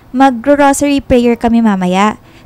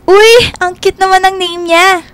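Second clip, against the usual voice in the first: higher, sweet gushing; 8 semitones higher than usual. Acted. Jillian hears the dog's name, gushing. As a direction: coo over the dog's name.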